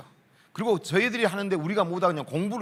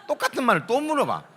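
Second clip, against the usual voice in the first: ordinary talk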